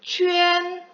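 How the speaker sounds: 취엔 held high and level as "qüen"; 취엔 stretched out as a high model syllable; model pinyin reading. Shown as a demonstration. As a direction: chant 취엔 on one high, sustained tone. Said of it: "얘는 뭐라고 읽어요?".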